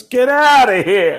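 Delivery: loud, high, drawn-out dismissal; retold shout. Dice to a passer-by, retold shout. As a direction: shout it like you're shooing off a pest.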